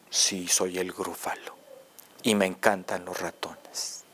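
low, slow growly Gruffalo; deep monster voice. Acted. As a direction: speak low and slow like a big beast.

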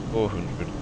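normal talking voice

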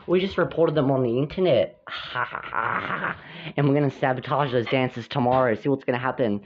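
low, scheming figure with a laugh; lightly voiced schemer. Plotting to sabotage the dances tomorrow, low scheming voice.

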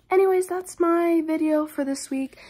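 normal talking voice

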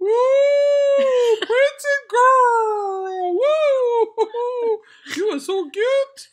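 high, loud hooting praise; mock cheering. Hyping up her daughter's filtered face, loud and high. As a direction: whoop like a fan in the crowd.

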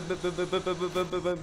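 normal talking voice

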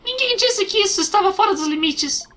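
high, squeaky fairy voice. Acted. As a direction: voice a tiny fairy, high and bright. Voicing fairy character lines.

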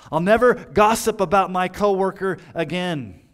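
hammed-up vows, building in force; mock pledge. Preacher voicing congregation's vows, loud and insistent. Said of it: "how many of you have ever pledged".

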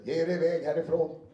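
the reader overshoots a line that should be whispered; loud mock threat. Shown as a demonstration. Shows how a whispered line gets read out loud. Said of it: "viskade hon".

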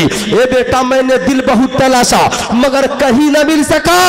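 mother's farewell letter read as a soaring sermon plea; emotional letter reading. Acted.

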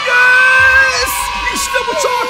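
high, very loud victory shout; full-voiced celebration; triumphant scream. Real, not acted. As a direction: explode with joy. Celebrates success.

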